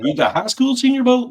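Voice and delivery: mock the outsiders' disbelief; mock incredulous quote. Outsiders scoffing at the teen star, in a high mocking voice. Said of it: "They'd be like".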